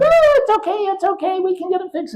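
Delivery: tiny, very high-pitched elves; elf voices. Acted. Elves reassuring Santa about the sleigh, high elf voices. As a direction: squeak out quick comfort as the elves. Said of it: "They all said".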